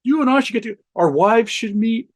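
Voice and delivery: a lightly mocking chummy stranger, slowing at the end; mock friendly stranger. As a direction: play the overly friendly spy at the bar. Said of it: "somebody who's like".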